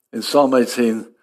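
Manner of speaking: normal talking voice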